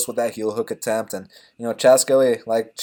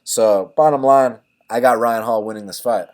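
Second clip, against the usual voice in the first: usual speaking voice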